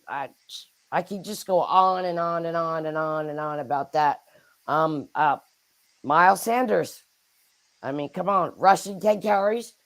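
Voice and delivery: ordinary talk, nothing marked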